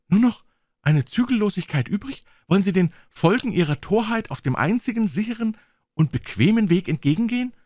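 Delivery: ordinary delivery, nothing marked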